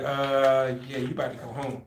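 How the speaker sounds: low, soft, deadpan delivery; imitating unbothered examiner. Examiner sending recruit home, deadpan. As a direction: break it quietly, no concern at all.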